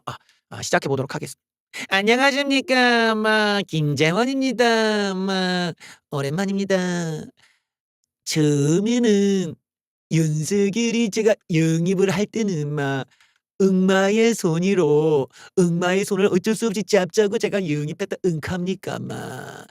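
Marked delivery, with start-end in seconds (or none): 1.9-7.2 slow, heavy Gyeongsang dialect greeting; Gyeongsang impression. Imitating politician Kim Jae-won's greeting in Gyeongsang dialect. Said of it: "경상도 사투리". 8.2-19.6 slow Gyeongsang dialect with 마 tags; Gyeongsang impression. Imitating Kim Jae-won recalling recruiting Yoon, Gyeongsang dialect. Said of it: "경상도 사투리".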